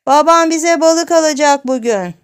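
put-on high, loud child's shout. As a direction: holler it to someone hard of hearing. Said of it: "diye bağırdı". (ağır işitene yüksek sesle bağırarak)

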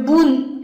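boon held on a final n; nasal closed syllable. Shown as a demonstration. Teacher demonstrating nasal ending, held slowly. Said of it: "鼻音符号的合口".

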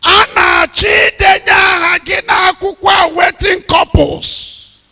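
high, loud, far-off cry of a goddess. Retold or imitated. Goddess in trance calling to preacher, loud and high. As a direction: ring it out like a voice from afar. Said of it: "and said to me in a loud voice".

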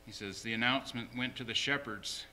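ordinary talk, nothing marked

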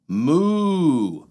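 playful stretched-out moo; cow moo. Acted. Acting Mister Brown's cow sound.

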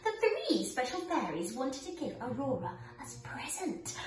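gentle storybook narration; reading to children. Acted. Reads Sleeping Beauty to children.

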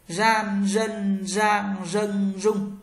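"Gian, dân, giang, dân, rung" each begun with a buzzed curled-tongue r; high level r syllables in "Gian, dân, giang, dân, rung"; voiced retroflex r. Shown as a demonstration. Teacher drills r for learners, "Gian, dân, giang, dân, rung" voiced and curled. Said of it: "R cong lưỡi và dây thanh rung".

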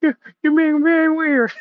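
loud, squeaky gremlin voice; hammed-up alarmed creature squeal; Gizmo. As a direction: protest in a tiny high voice. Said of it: "He's like".

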